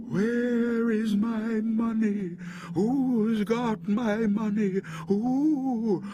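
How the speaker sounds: high, slow ghostly wail; drawn-out haunting cry from far off; distant wailing voice. A narrator's reading. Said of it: "It was a voice crying".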